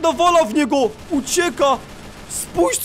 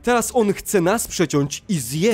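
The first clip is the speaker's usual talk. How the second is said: low, slow ominous storyteller; spooky recap; 6 semitones lower, slower than usual. Acted. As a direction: recap the horror slowly and low. Dramatic recap to viewers. (złowieszcze podsumowanie dla widzów)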